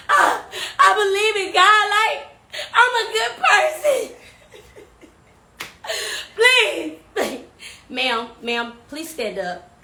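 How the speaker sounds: normal talking voice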